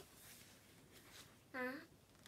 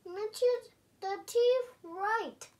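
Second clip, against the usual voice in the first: higher, louder panicky listing; alarmed pigeon; 7 semitones higher, 12 dB louder than usual. Acted. Pigeon scared of the big dog, higher and louder. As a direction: rattle off each scary feature.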